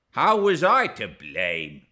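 loud, indignant question; aggrieved protest. A narrator's reading. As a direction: push back, stung by the blame. Earnshaw protests at her accusation.

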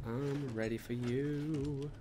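slow drawn-out creepy taunt; sing-song taunting. Pretend. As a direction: stretch out the you, luring. Taunting hidden enemy.

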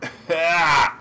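sudden loud, high yell; shout. Real, not acted. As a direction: yell out mid-game. Startled shout while playing.

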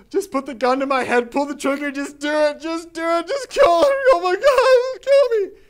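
high, loud hysterical plea, building to a wail; acted desperate begging. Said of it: "Then the guy starts begging me".

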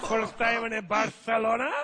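Spanish lisp. Retold or imitated. Greeter asking a tourist in Barcelona, lisping Spanish accent. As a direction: lay on the Castilian lisp. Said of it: "with a lisp".

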